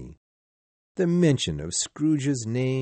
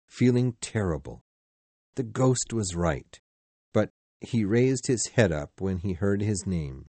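plain reading voice

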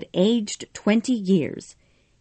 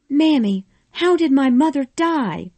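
higher, pleading question; crying weakly; 8 semitones higher, 4 dB louder than usual. A narrator's reading. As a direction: ask it through grief. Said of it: "Scarlet cried weakly".

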